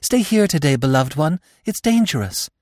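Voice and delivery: very high, anxious begging. A narrator's reading. Marina begging her beloved Gobo to stay, pleading. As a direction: implore in a high-pitched voice. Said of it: "Even Marina begged".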